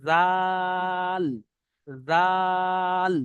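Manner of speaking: জাল drawn out very long; long jal. Shown as a demonstration. Teacher demonstrating long zal to online class. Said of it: "নোক্তা দেখে চিনতে হবে".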